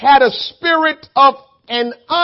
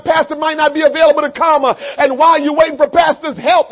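usual speaking voice

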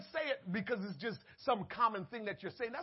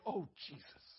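hushed oh Jesus; 8 semitones lower, 12 dB quieter than usual. Retold or imitated. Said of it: "You don't hear nobody saying".

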